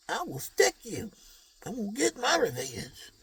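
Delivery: put-on high, loud, cranky old man threats; feisty old gangster. Seventy-year-old gang member threatening his rival at full voice. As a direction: squawk like a grudge-holding old man.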